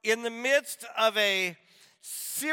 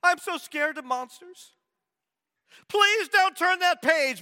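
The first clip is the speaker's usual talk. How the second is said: higher puppet monster pleading; Grover begging; 8 semitones higher, 4 dB louder than usual. Retold or imitated. Grover begging the reader not to turn the last page, high plea. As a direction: beg like a scared little monster. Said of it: "It says".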